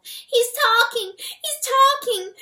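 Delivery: high, loud panicked mouse shriek. A narrator's reading. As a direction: shriek in terror like a tiny mouse. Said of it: "shrieked the little mouse".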